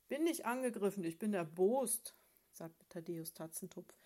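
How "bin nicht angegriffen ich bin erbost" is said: loud, indignant grumble; a cross bear of a husband, complaining loudly; the husband bear, snapping at his wife; grumpy bear. Acted.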